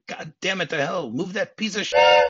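high-pitched imitation of an irate cop yelling; Lorenzo screaming. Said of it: "then Lorenzo screams out".

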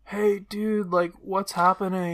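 muffled through a door. Acted. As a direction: voice muffled behind a wall. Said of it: "Eugene's voice muffled from inside the door".

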